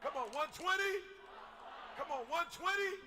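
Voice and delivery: high, loud rallying call; rally the crowd, shout it out; urgent shout. Real, not acted. Preacher urging listeners toward one twenty, shouting.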